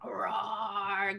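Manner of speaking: low, soft playful roar; tiger roar. Pretend. Teacher gives a gentle tiger roar for the class. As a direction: growl it like a friendly tiger. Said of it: "what kind of noise does a tiger make?".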